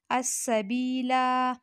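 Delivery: अस्सबीला drawn out slowly; slow recitation demo. Shown as a demonstration. Teacher introducing the word slowly. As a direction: spell out अस्सबीला carefully. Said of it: "हम पढ़ेंगे अस्सबीला".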